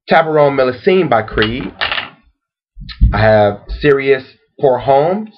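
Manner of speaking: measured recap in a lifted pitch; slow repeat. Real, not acted. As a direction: space the names out with a long pause. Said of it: "let me repeat it slowly".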